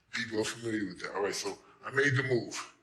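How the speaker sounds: normal talking voice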